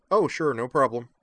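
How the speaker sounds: bright, carefree reassurance; cheerful. Acted. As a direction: toss it off happily. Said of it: "said Aslan cheerfully".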